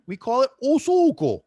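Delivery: o sugo in proud, loud Sicilian dialect; Sicilian pronunciation. Shown as a demonstration. Host showing the Sicilian word, loudly and proudly. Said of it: "In Sicilian".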